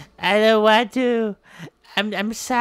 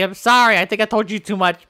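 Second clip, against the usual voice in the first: ordinary talk, nothing marked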